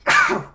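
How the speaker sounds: sharp loud cough; loud cough into mic. Real, not acted. Coughs after sneeze.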